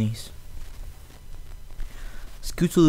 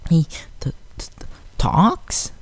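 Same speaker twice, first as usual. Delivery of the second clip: higher, breathy frightened stammer; scared stutter; 5 semitones higher, slower than usual. A narrator's reading. Filly shocked the robot can speak, stuttering. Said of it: "Sweetie stuttered".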